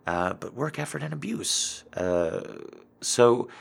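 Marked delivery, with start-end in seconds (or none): none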